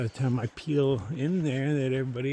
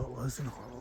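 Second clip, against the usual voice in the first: much softer, to himself; soft reassuring mutter; 10 dB quieter than usual. Real, not acted. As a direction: quietly reassure yourself.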